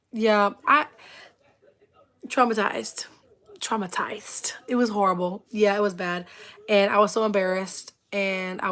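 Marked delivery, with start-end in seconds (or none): 3.6-4.5 hushed, whispered repeat for effect; dramatic whisper. Real, not acted. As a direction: whisper it softly for emphasis.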